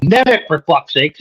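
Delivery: slow, half-strength yell of frustration; retold yell. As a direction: re-enact an exasperated yell, drawn out. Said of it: "As soon as I yelled".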